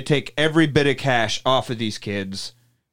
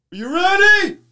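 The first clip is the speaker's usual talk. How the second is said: high, louder teasing question; hammed-up gleeful bar owner; mock taunting host; 4 dB louder than usual. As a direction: tease them before the trap.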